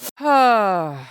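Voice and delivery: loud, falling exhale; long sigh before speaking; heavy sigh. Real, not acted. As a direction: let out a big sigh. Exasperated sigh before new topic.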